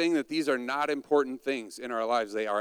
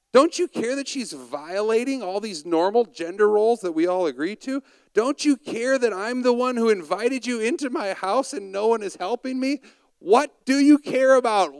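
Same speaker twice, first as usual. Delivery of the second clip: higher, louder, exasperated pleading; Martha's complaint; 7 semitones higher, 4 dB louder than usual. Acted. Martha scolding Jesus about her lazy sister, exasperated. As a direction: hammer each "don't you care" at Jesus.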